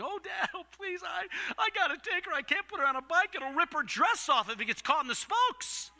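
high-pitched, whiny begging to Dad; hammed-up teenage plea; pleading. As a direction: beg desperately like a kid wanting the car. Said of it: "prayers of pleading".

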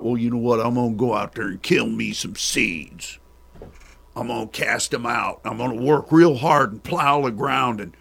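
loud, drawling Southern farmer voice; mock rural Southern accent. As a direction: ham up a country farmer talking big. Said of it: "How you like that accent?".

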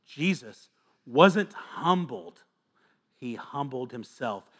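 slow, deliberate teaching emphasis. Shown as a demonstration. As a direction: spell it out slowly, word by word. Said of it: "There wasn't some outside force".

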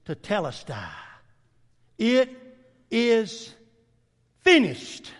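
drawn out word by word, hushed and solemn; solemn last words. Acted. You know what He said?.

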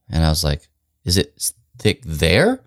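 ordinary talk

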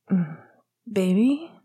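pillow-muffled, drowsy question; half-asleep mumble, rising at the end; sleep-slurred. A narrator's reading. Remus woken beside Sirius, sleep-slurred and muffled. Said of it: "The sleep-slurred, pillow-muffled voice".

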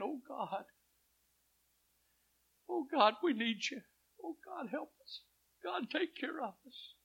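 high, pleading cry to God, fading to a whisper; retold desperate prayer. Husband alone under the car at night, crying out to God in a high, pleading voice. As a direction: beg God as if in tears, dropping to a whisper. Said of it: "He was crying out to God".

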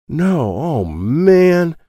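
high, loud, slow crying wail; anguished wail. Acted. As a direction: stretch it out in despair. Felipe despairs at the kid.

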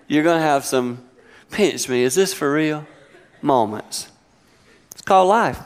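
ordinary delivery

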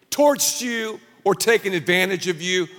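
ordinary delivery

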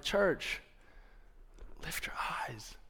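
hushed, tender near-whisper; lovingly. Retold or imitated. Jesus lovingly addressing his church, tender and hushed. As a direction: voice it softly, like a gentle coaxing. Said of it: "Jesus here in this passage so lovingly".

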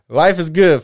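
life is good through a laugh, loud and slow; loud, slow, laughing cheer. Real, not acted. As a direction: laugh it out happily.

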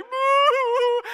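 high, drawn-out fake boohoo; mock crying. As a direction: wail like a crying mom, hammed up. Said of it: "my mom starts crying".

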